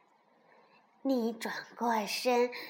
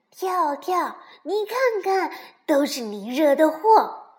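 loud scolding, higher pitched; 大声责备; 4 semitones higher, 9 dB louder than usual. A narrator's reading. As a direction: blame your friend at full volume. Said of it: "蹦蹦大声地责备道". (大声地责怪对方)

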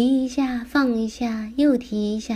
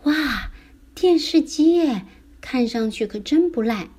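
impressed wonder; 5 semitones higher than usual. Acted. Character admiring a television that looks really good, impressed. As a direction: marvel at a great find.

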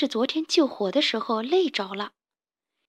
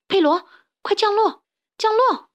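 very high, loud urgent cry; yelling; 10 semitones higher, 6 dB louder than usual. Acted. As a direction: shout the command to land. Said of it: "卡门叫道".

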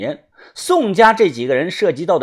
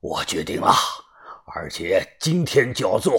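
resolved but weary elder, slightly lowered voice; regretful old man; 5 semitones lower than usual. A narrator's reading. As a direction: voice an old man admitting defeat. (苍老而懊悔的老人声音)

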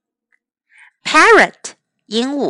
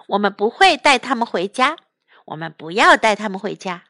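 ordinary delivery

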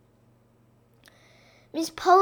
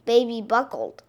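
ordinary delivery, nothing marked

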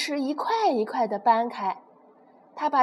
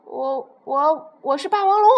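little pterosaur pretending to be a T-rex; straining yell; 3 semitones higher, 5 dB louder than usual. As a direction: bellow with all your strength to sound big. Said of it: "使出浑身力气，扯着嗓子吼叫道".

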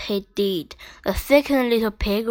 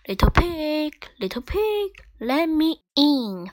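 a hint of a gruff wolf, pitched up; 7 semitones higher than usual. A narrator's reading. Wolf calls to the pig at the door, lightly gruff. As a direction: call through the door like a sly wolf. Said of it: "said a gruff voice".

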